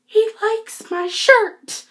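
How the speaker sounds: high, slow, breathless squeal of delight; giddy squeal. Retold or imitated. Fan squealing over her idol's compliment, giddy squeal. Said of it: "And then I'm just, like, dying".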